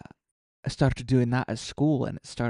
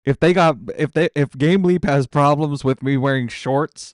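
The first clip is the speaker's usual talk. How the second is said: loud protest; 4 semitones higher, 13 dB louder than usual. Real, not acted. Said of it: "Oh my gosh".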